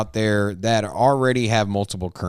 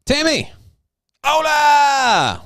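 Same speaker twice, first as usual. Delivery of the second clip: excited greeting; 12 semitones higher, 4 dB louder, much slower than usual. Real, not acted. Welcoming a chat member excitedly.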